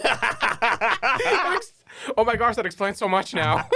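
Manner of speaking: high, loud, talking through laughter; real laughing. Real, not acted. Friend cracking up at a hybrid joke, laughing hard. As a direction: crack up while getting the words out.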